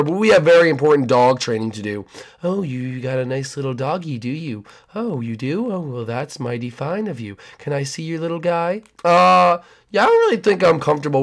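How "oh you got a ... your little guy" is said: creepy neighbor. Acted.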